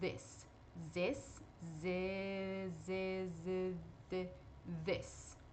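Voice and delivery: soft "This" and buzzing "z" with the tongue between the teeth; voiced th drill. Shown as a demonstration. Teacher drilling the voiced th of this, softly. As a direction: repeat "This" and "z" with a buzz. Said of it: "esse é o som".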